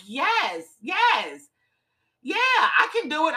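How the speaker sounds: rising to a high, excited yes, yes, yeah; getting excited. Real, not acted. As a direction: let the excitement burst out as you say it. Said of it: "the more excited I'm getting".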